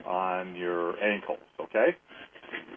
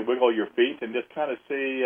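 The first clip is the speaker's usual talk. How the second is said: ordinary talk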